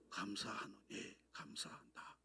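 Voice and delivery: slow, hushed whisper of thanks; soft hushed quote. Acted. Preacher to congregation, hushed whispered thanks. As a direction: breathe the words like a quiet prayer. Quoting Paul's opening softly.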